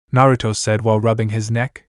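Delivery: ordinary delivery, nothing marked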